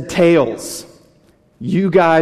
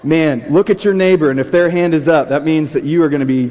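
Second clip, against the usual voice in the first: ordinary talk, nothing marked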